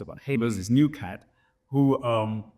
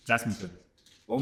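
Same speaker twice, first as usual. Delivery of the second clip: laughing; 2 semitones higher than usual. Real, not acted. Laughs sharing favorite.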